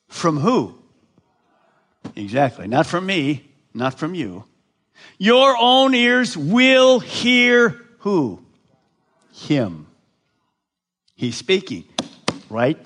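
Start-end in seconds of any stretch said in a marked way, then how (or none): 5.2-7.8 slow, higher and louder, every word weighted; emphatic slow quote. Shown as a demonstration. Pastor proclaiming the promise to the congregation, slow and loud. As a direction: proclaim it slowly and loudly. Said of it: "emphatic quote".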